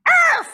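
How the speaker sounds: high loud dog bark; mock yap at the neighbor's dog; barking back. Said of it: "I'm gonna be going".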